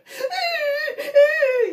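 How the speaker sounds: high, loud laughing sob; real teary laughter; crying laugh. Real, not acted. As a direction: laugh until it cracks into tears.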